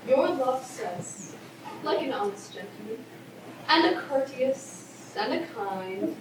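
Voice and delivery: teasing delay. Acted. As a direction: tease out the message unhurriedly. Nurse teasingly withholds Romeo's message.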